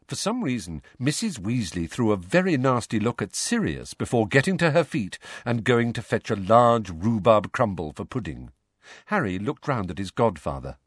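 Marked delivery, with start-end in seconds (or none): none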